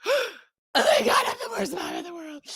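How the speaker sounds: high, loud cry of guilt; guilty wail. Retold or imitated. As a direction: cry out in horror at herself. Mom guilt hits after screaming.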